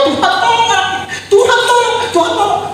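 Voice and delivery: high, pleading cry to God; desperate plea. Retold or imitated. Retold desperate prayer for son. (permohonan putus asa dengan suara tinggi)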